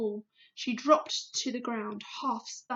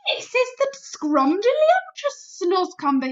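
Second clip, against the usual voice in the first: slow, higher, savouring each word; BFG, coaxing; 8 semitones higher, 9 dB louder than usual. A narrator's reading. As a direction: sell the vegetable with relish. BFG voice, praising slowly.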